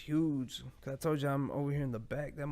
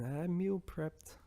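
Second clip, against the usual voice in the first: self-mocking; 4 semitones higher than usual. Real, not acted. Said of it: "I know I sound so fucking stupid".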